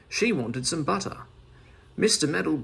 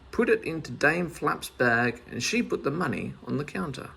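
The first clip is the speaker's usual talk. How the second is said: usual speaking voice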